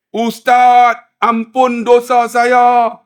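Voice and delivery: slow, high, drawn-out begging; loud pleading. Retold or imitated. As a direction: cry out for forgiveness. Acts man begging forgiveness.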